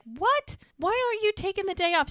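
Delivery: high, rapid incredulous question; mock disbelief. Sister's retold disbelief at working.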